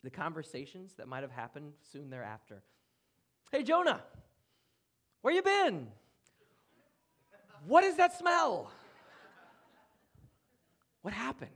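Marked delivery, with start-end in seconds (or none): 3.5-11.5 high, loud, shocked friends calling out to Jonah; much higher and louder, as a friend who has just caught the smell; friends greeting Jonah. Pretend. Friend greets Jonah back on shore, high and loud. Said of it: "imagine with me just for a moment here the conversations".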